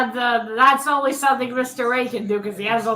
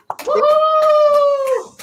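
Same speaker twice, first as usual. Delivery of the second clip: high, loud whoop of celebration; excited cheer; 12 semitones higher, 6 dB louder than usual. Real, not acted. Host whooping for the weekly winner, excited.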